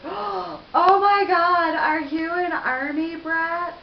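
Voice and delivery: loud, high, drawn-out gushing; hammed-up thrilled question; mock excitement. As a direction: imitate an overexcited stranger, stretching the words. Mimics the guy's excited question.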